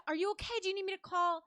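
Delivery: high, loud, urgent offer of help; alarmed, acted-out pleading; retold panic. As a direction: re-enact frantic concern for an injured stranger. Retelling frantic offer to call help.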